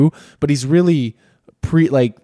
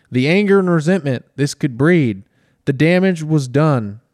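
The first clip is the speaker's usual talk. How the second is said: mock Southern accent; 3 semitones higher than usual. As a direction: stretch it out in a hint of a Southern accent. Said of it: "now you're doing a Southern accent".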